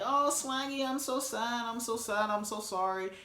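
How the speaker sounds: high, mock-whiny pity voice, fading toward the end; mock sad Caitlin. Vlogger mocks a houseguest's fake sympathy in a high whiny voice. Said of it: "Caitlin's going on and being like".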